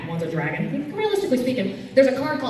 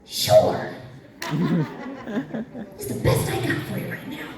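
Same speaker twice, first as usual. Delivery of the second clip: rasped, voiceless villain voice with laughter; Shigaraki. Retold or imitated. Panelist obliges an audience request, villain impression. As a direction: drop into a creepy whispered rasp and cackle. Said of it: "I can do a Shigaraki impression".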